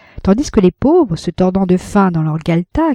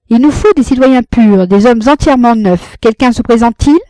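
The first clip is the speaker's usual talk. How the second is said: dogmatic; 5 semitones higher, 6 dB louder than usual. A narrator's reading. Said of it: "d'une façon dogmatique".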